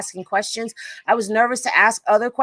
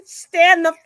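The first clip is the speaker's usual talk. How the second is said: loud, high, drawn-out mimicry; amused mocking quote; 7 semitones higher, 8 dB louder, slower than usual. Host repeating the clip's insult to viewers, amused loud mimicry. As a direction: quote her loudly, tickled by the line. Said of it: "she said".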